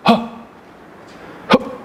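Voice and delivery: sharp startled gasp, breath caught; 놀란 숨 들이켜기. Shown as a demonstration. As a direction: gasp in sudden fright as a demo. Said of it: "우리 놀랄 때 어때요?". (깜짝 놀라 숨을 헉 들이쉬는 소리)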